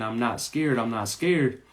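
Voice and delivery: ordinary talk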